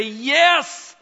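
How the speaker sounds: loud, high, emphatic yes. Pretend. Grandfather answering God for his granddaughter, emphatic. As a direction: answer God with a firm cry.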